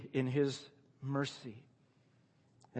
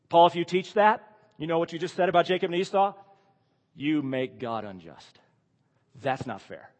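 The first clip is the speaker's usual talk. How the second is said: a hint of an indignant challenger, louder and quicker at first; lightly voiced heckler, louder then settling; mock objector; 3 semitones higher, 7 dB louder than usual. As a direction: play the sceptic protesting to Paul. Voices an imagined objector to Paul.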